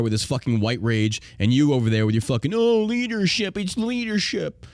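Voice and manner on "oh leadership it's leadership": high, slow, mocking whine; mocking whiny voice. Host mocking the sergeant major, high and slow. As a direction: sneer at his empty leadership talk.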